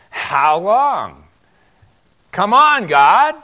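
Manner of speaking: loud and high, come on God; loud exasperated plea to God; exasperated cry. Retold or imitated. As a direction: throw up your hands at God.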